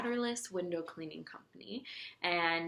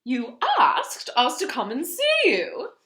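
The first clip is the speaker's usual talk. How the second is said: loud, slow, higher giraffe voice; 7 semitones higher, 11 dB louder, slower than usual. A narrator's reading. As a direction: remind the duke slowly and loudly. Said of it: "the giraffe said".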